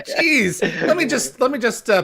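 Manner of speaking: high, loud, laughing exclamation; incredulous laughing outburst. Real, not acted. Laughing over villain's nerve.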